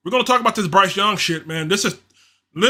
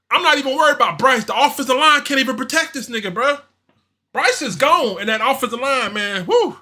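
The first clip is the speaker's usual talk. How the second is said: higher, fast worked-up rant; heated rant; 6 semitones higher than usual. Real, not acted. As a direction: vent it, getting worked up. Heated rant about offensive line.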